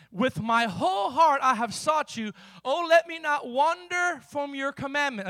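ringing scripture recitation; declaimed scripture. Real, not acted. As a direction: proclaim the verse to the room. Reads scripture aloud to congregation.

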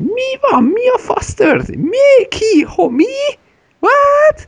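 squeaky high baffled outburst. Pretend. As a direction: yelp it out, totally lost. Imagined baffled reaction to film.

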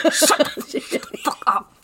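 slow, voiceless mock-furious hiss; whisper-shout it through laughter; hissed scolding. Imitating Mommy silencing Melody, hissed mock fury.

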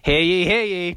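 lightly voiced town crier call. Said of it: "act like town criers".

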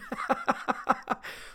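cracking up at own story; high-pitched wheezy laughing fit. Real, not acted. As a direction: burst into helpless high laughter.

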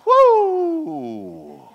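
high, loud whoop of relief; preacher's loud high whoo; relieved whoo. Retold or imitated. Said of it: "I went".

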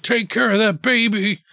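high-pitched, comic last cry; hammed-up parting shout; mock desperate yell. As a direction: call it out like a falling movie hero. Man falling from plane calls out.

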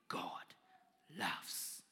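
soft whisper; hushed loving whisper; whispered tenderly. Retold or imitated. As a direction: breathe the words gently. Old man's insistent message.

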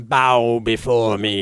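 slow, loud commanding decree; booming demon lord. Pretend. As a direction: command them to kneel. Grandiose Magnus impression.

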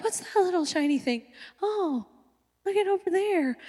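soft, mock-entranced murmur; hushed distraction. Sidetracked kid spotting a shiny thing, hushed distraction. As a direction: trail away softly, lured by something else.

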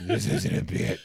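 unvoiced rasp, hammed-up Batman; half-whispered Batman growl for laughs; a jokey Batman voice; mock Batman. Voicing Batman's deadpan reply.

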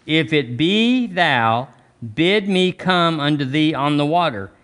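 slow, each word weighed; slow emphatic reading. Shown as a demonstration. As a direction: read it again slowly, savouring every word. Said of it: "Look, look".

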